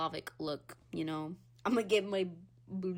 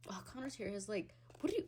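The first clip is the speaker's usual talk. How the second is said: ordinary talk, nothing marked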